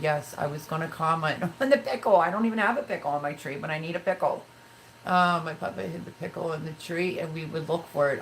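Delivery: ordinary talk, nothing marked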